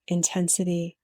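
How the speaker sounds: ordinary talk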